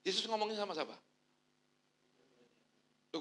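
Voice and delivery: usual speaking voice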